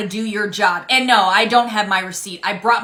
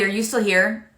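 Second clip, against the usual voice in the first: usual speaking voice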